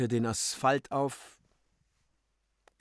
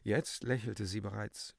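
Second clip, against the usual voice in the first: usual speaking voice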